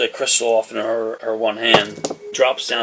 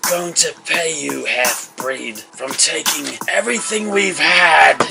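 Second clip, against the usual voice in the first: raised voice building to a high cry; angry threat; 5 semitones higher, slower than usual. Acted. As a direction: spit the threat at your enemy, rising at the end. Enemy threatens while lunging.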